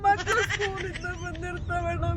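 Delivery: slow, crying plea; tearful pleading. Real, not acted. Mother begs kidnapper as children are due from school, crying.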